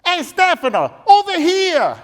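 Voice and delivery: loud, high Italian dad calling across the room. Retold or imitated. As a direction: shout him over like an Italian father. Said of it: "He's like".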